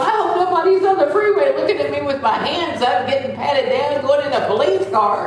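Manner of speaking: high-pitched, mortified fretting; hammed-up mortified wail, breaking into a laugh; retold embarrassment. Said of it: "I was so embarrassed".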